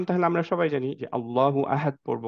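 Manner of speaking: ordinary talk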